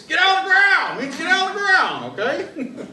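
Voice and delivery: high shouted cop command; mock police yell. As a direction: yell it like a panicked officer. Mocking officer's yell.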